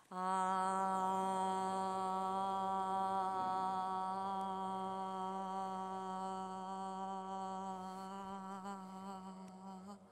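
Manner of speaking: a loud, long, steady "아" on one pitch; long called-out ah. Real, not acted. Calling the dancers out with the congregation, loud held "아". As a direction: call out and hold the vowel to summon the dancers. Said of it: "다 같이 아 하면서 불러내겠습니다".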